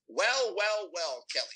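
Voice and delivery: lightly imitated accent of a colleague, teasing drawn-out greeting; mock-ominous greeting in a colleague's accent; colleague's classic accent. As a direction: imitate his accent, opening high and falling. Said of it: "in his classic accent".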